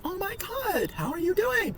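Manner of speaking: put-on high, squealing girlfriend greeting; high mock girlfriend voice, surprised and delighted; girly voice. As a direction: squeal it like an excited girl spotting a friend. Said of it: "she's like".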